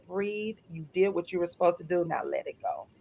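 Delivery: soft, hushed coaching; calming. Retold or imitated. Coach calms client after she forgave her ex, soft reassurance. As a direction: gently talk someone down, retold.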